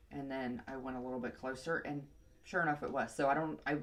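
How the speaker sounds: usual speaking voice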